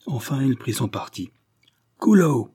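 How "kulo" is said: high, loud falling cry of a name; narrated sheriff's shout; calling out. A narrator's reading. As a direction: shout to someone hidden. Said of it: "cria-t-il".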